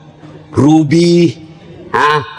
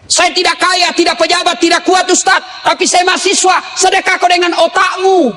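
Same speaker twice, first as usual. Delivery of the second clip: preaching shout; 7 semitones higher than usual. Real, not acted. Preacher shouting to students to give with their minds. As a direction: call it out to the crowd.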